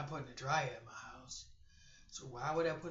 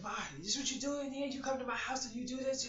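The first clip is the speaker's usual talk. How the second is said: mock accusing; 9 semitones higher, faster than usual. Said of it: "And I said".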